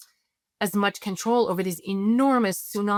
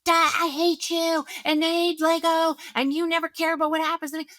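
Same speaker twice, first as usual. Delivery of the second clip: put-on child's outburst; 9 semitones higher than usual. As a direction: whine it like a hurt eight-year-old. Parent imitating the screaming child.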